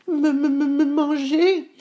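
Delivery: very high stuttering goat voice; squeaky-high goat, tripping on the first word; frightened little goat, stammering. Acted. Frightened Petit Bouc stammers.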